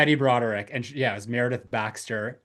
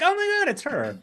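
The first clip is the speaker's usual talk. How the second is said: surprised. Retold or imitated. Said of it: "I'm like".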